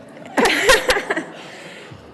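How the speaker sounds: high, loud burst of laughter; loud nervous laugh. Real, not acted. Loud laugh at her own remark.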